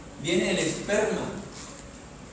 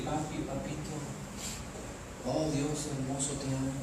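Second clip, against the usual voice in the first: soft, lowered, intimate prayer; tender reverence; 3 semitones lower, 8 dB quieter than usual. Acted. Believer addressing God as Papi with respect, tender reverence. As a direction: murmur it to a beloved father. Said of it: "le tiene a Él un alto respeto y dice".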